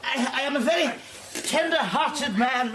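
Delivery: a high, overwrought plea; agitated. Acted. Invalid begging the visitor's pardon, high and overwrought.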